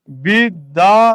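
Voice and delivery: "বিদ্যালয়" drawn out as bid, da, lo, yo; slow, split "বিদ্যালয়" with a separate final yo; wrong open ending. Shown as a demonstration. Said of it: "আপনি বিদ্যালয় বলেন না".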